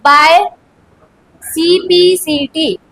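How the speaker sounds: each letter of CPCT said slowly and separately; slow dictation to students; slow spelling out. Shown as a demonstration. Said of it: "ये तुम्हें बहुत जगह लिखा मिलेगा".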